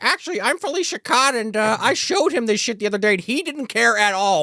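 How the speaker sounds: high put-on woman's voice; lightly mocking high-pitched character voice; eager assistant. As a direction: introduce yourself with hammy keenness. Acting eager assistant introducing herself.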